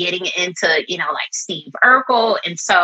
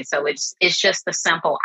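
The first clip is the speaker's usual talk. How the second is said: ordinary talk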